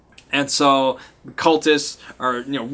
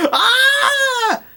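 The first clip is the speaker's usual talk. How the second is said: 1 mock scream; 4 dB louder than usual. Scream from behind curtain.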